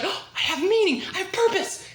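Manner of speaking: hammed-up high, eager realisation; mock revelation. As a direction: act out a sudden joyful epiphany, pitched high. Acts out zombie's joyful revelation.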